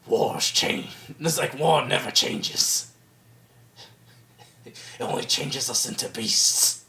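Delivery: low, raspy whispered growl; Solid Snake. Retold or imitated. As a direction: mimic a grave, breathy game hero. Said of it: "like, Snake is like".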